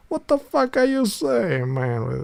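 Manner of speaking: high, slowed-down mock Italian outrage; Italian exasperation. Shown as a demonstration. Demo of how Italians react, high and slow. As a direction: stretch it out like a fed-up Italian. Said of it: "Italians say, say like this".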